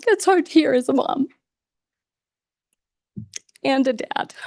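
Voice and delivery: tears winning on the repeat; real voice cracking as a parent; choked up. Real, not acted. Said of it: "I'm getting a little choked up".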